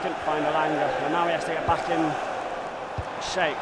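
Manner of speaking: steady football commentary call; live play-by-play. Acted. Gamer voicing commentary over his own match, play-by-play. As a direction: narrate the action like a TV commentator.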